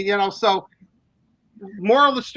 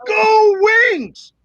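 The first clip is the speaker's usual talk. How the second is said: high, loud arena shout; loud fan yell; 10 semitones higher, 5 dB louder than usual. Retold or imitated. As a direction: belt it out like a stadium announcement. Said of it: "That's actually my voice".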